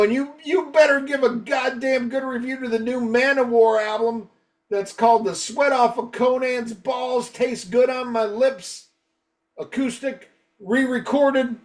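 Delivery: high mock-fan demand; imitating an irate superfan; retold brutal threat. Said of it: "that was the most brutal one".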